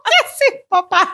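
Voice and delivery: high, laughing disbelief; mock shocked question. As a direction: squeal it at dad, laughing. Her own retold shocked question. (hija incrédula a su papá, aguda y riendo)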